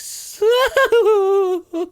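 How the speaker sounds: loud, high, long wailing laugh; outburst of laughter. Shown as a demonstration. Comic shows how a good laugh comes out, loud high wailing laugh. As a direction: laugh so hard it sounds like something is wrong. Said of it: "a good laugh comes out like jazz".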